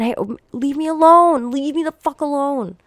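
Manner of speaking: a desperate plea to be left alone; pleading to be left alone. Pretend. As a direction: beg them to leave you alone. Voicing what she wants said.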